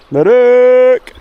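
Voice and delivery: a high, loud shout to call him back; a high, loud call across the hillside. Real, not acted. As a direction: shout the name so it carries. Calling out to a dog.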